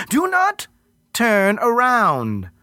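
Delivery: high then very slow command; thunderous command. Acted. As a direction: stop him cold, slowly. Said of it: "Words came out like thunderclaps".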